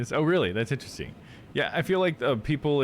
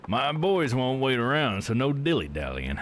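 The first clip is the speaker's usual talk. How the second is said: put-on southern accent; 3 semitones higher than usual. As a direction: stretch it out like a southern game character. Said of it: "I've been giving such a southern accent".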